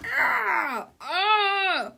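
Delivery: high, loud "aah" of horror; cartoon scream. A narrator's reading. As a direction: scream twice in mock terror. Onlookers scream at villain.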